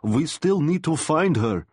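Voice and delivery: put-on high-pitched, urgent Russian-accented plea; thick Russian accent. A narrator's reading. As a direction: plead as an old father with a thick Russian accent. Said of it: "his voice still thick with a Russian accent".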